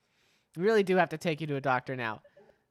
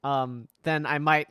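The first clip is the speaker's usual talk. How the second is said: usual speaking voice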